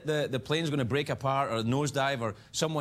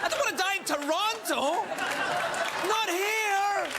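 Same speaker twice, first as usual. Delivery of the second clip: whiny dread; slower than usual. Acted. Comic mock-scared whine about Toronto.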